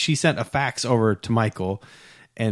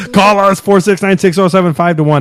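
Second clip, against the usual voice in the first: loud, higher hammed-up commercial voice; mock ad announcer; 7 semitones higher, 12 dB louder than usual. Host hams up a commercial voice reading the number. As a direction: sell the phone number like a radio ad. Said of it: "You sound like the guys that enter commercials".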